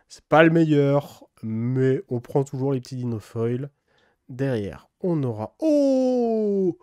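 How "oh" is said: very high excited oh; a real burst of joy at the card; high, thrilled oh. Real, not acted. Spots a rare card.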